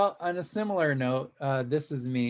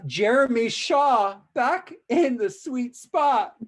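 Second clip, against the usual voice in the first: excited announcer; 8 semitones higher, 6 dB louder, slower than usual. Acted. Sing-song shoutout name.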